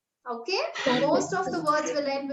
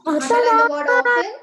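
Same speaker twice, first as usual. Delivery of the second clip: raised, faster repeat of "Underline the word often"; louder repeat; 7 semitones higher, 7 dB louder, faster than usual. Real, not acted. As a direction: call "Underline the word often" out over the class, higher and quicker. Teacher repeats instruction to class.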